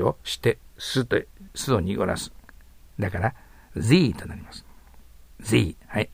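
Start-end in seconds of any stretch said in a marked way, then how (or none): none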